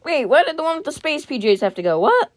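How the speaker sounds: high, loud disbelief; squeaky, incredulous outcry; shocked protest. Real, not acted. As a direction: protest in a high voice, jumping up on the last word. Protesting a character being taken.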